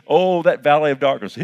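loud, high, ringing preacher's call; preaching at full force. Acted. Pastor preaching loud to the congregation, full force.

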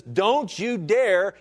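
a touch of divine rebuke; stern warning. Acted. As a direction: draw it out like a stern command. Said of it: "He says".

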